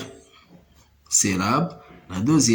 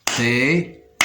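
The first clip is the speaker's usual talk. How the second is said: "Tête" in two separate beats, tê, then te; split into syllables. Shown as a demonstration. Teacher counting syllables for learners, "Tête" split in two. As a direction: say "Tête" slowly syllable by syllable, pausing before the final te. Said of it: "On va découper les mots en syllabes".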